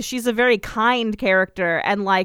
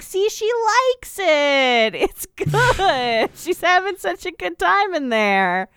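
smug possessed nun; 8 semitones higher, slower than usual. Retold or imitated. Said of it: "nasty Mary Eunice takes back over and is like".

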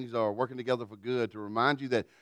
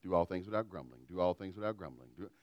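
softer, repeating it under the breath; muttered to myself; 6 semitones lower, 11 dB quieter than usual. Retold or imitated. Tired parent quietly preaching the verse to himself. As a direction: coach yourself quietly. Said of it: "I would preach it to myself".

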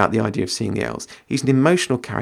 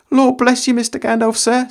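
high, louder flustered country lad; Sam voice; 11 semitones higher, 5 dB louder than usual. Acted. Sam caught eavesdropping pleading with Gandalf, high and loud. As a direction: pitch it up like a caught, nervous gardener. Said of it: "said Sam".

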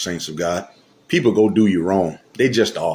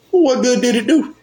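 pitched way up, incredulous; mocking high question. Real, not acted. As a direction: scoff at the churchgoer's behavior.

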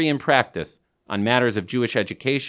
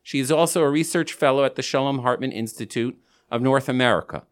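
usual speaking voice

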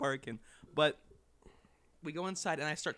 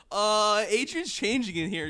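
loud, high, panicky heads-up; blurted warning; 6 semitones higher, 11 dB louder, slower than usual. Retold or imitated. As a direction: reenact a hasty warning at full volume. Retells blurting warning to mom.

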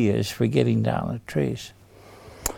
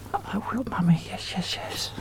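put-on softer whispered child's promise; whispered prompt; 5 semitones higher, 8 dB quieter than usual. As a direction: whisper it for the kids to echo.

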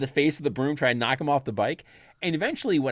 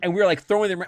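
ordinary talk, nothing marked

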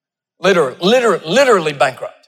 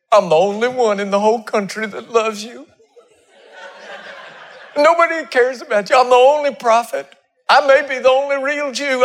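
higher, mock whining Elijah with a laugh; whiny self-pity; 7 semitones higher than usual. Preacher mimicking self-pitying Elijah, mock whine. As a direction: ham up the sulking prophet.

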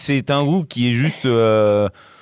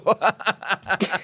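high, soft giggle; laughing; 5 semitones higher, 5 dB quieter than usual. Real, not acted. Co-host teasing the choice of song, giggling. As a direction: giggle at your own teasing.

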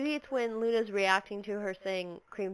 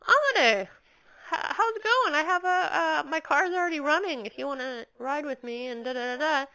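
much higher, louder eager stranger; elevator guy voice; 6 semitones higher, 4 dB louder than usual. Retold or imitated. Elevator stranger offering a ride, high and eager. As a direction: pitch way up like a pushy friendly stranger. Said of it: "this guy in the elevator who's like".

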